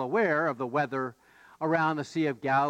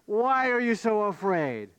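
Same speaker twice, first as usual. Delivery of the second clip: raised pitch, falling in disbelief; incredulous echo; 4 semitones higher than usual. Acted. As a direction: hurl the question back, indignant. Disciples throw question back, incredulous.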